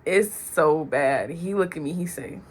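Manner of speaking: ordinary talk, nothing marked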